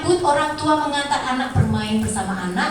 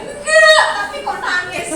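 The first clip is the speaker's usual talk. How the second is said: very high, loud mock wail; imitated TikTok child; 11 semitones higher, 6 dB louder than usual. Lecturer re-enacts TikTok child and mother, mock wailing. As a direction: squeal the denial like a child. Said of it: "seperti yang di TikTok tadi".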